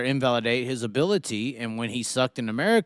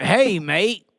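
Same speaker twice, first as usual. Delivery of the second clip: high, loud Aussie greeting; a bright "Hey, mate." in a put-on Australian accent; 7 semitones higher, 6 dB louder than usual. Reading the driver's text aloud in an Australian accent. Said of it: "Say it in an Australian accent".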